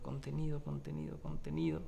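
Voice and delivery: contained, held back. Shown as a demonstration. Musician describing how the piece stays restrained, hushed and contained.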